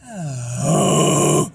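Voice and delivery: rough airy exhale; voiceless sigh. Shown as a demonstration. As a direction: push out air with no voice. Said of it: "take all the voice out".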